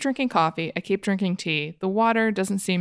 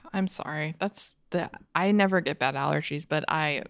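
sympathetic; 5 semitones lower, 5 dB quieter than usual. Real, not acted. Sympathizing with allergies.

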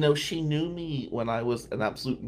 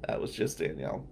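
ordinary talk

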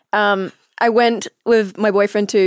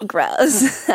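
Valley girl disdain, lightly hammed up; Valley girl accent. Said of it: "a valley girl".

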